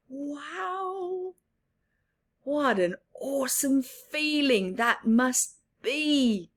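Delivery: ordinary delivery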